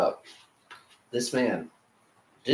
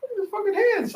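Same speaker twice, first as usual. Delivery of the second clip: amazed. Retold or imitated. Amazed telling wife.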